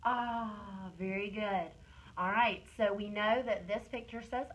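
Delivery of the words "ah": long falling "ah" sigh; drawn-out "ah". Shown as a demonstration. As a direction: hold the "ah" and let it fall. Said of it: "Do it again".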